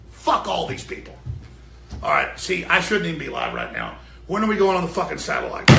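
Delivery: usual speaking voice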